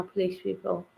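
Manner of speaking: ordinary delivery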